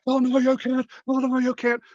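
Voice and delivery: very high, whiny mock protest; imitating John Boehner. Mocking John Boehner whining on the Senate floor, very high. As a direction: squeal like a sore loser. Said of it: "would sit there on the floor and go".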